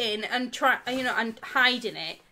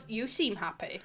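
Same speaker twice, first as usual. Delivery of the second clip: suspicious tone; 3 semitones lower, 8 dB quieter than usual. Shown as a demonstration. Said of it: "it does depend how you say it".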